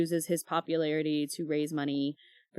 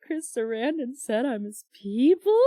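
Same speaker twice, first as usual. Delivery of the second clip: high, louder incredulous fangirl question; starstruck disbelief; 6 semitones higher, 3 dB louder than usual. Retold or imitated. Fan stunned that her idol called her his people, high excited disbelief. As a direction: replay the shock of being claimed as one of his people. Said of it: "And I was like".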